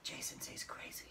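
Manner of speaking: hushed, voiceless play-acted gossip; children whispering. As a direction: pretend gossip in a very soft whisper. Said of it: "The children are whispering to each other".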